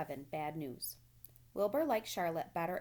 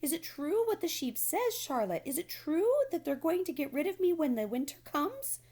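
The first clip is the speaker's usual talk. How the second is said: much higher, loud, desperate questioning; panicked high squeal; frantic pleading; 11 semitones higher, 8 dB louder than usual. A narrator's reading. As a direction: beg the spider for the truth in terror. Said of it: "screamed Wilbur".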